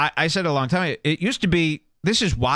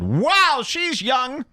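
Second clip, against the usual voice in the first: put-on high, amazed "wow, she's young"; mock surprised friends; 11 semitones higher, much slower than usual. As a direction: play the impressed buddies, pitched up. Friends react to teen girlfriend.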